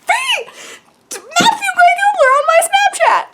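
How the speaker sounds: very high, loud, drawn-out shriek; fangirl squeal. Real, not acted. Fan showing off the star's selfie, squealing. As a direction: squeal it slowly, overcome with excitement.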